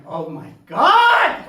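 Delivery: loud, drawn-out cry of disbelief; shocked exclamation. Acted. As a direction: blurt it out in stunned shock, stretching the last word. Acted shocked reaction to news.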